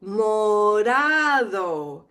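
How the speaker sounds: Morado with a soft tapped D, slow. Shown as a demonstration. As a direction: tap the tongue gently on the D in Morado. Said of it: "pronounced like a soft D".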